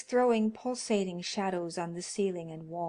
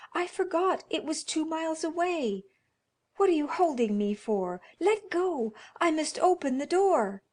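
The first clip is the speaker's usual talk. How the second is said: frantic pleading; 9 semitones higher, 6 dB louder than usual. Acted. Wife struggling with husband.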